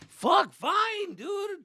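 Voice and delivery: high, loud frustrated surrender; exasperated. Retold or imitated. Son giving in after mom sides with dad, exasperated. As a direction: give in with a burst of frustration.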